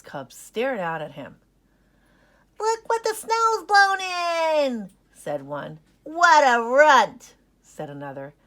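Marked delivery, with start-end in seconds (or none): 2.6-4.9 high, loud, slow jeer; mocking sneer. Fox cub taunts lost puppy. 6.0-7.3 very loud, slow insult; scornful jeer. Acted. Second cub insults Ollie.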